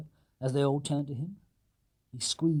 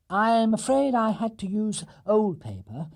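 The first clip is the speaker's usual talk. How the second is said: higher, slow and sorry; higher, slow bear apology; apologetic; 8 semitones higher, 4 dB louder, slower than usual. Acted. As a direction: apologise gently as the little bear. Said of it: "said Paddington apologetically".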